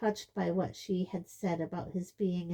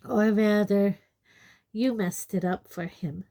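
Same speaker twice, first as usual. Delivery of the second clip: satisfied smile; 3 semitones higher, 11 dB louder than usual. A narrator's reading. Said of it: "said Lupin with a satisfied smile".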